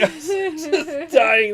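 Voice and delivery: gasping with laughter; laughing hard. Real, not acted.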